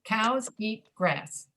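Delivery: "Cows eat grass" slowly, all three words equally stressed; even stress. Shown as a demonstration. Teacher modelling stress timing, "Cows eat grass" evenly stressed. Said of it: "stress is pretty even on all three words".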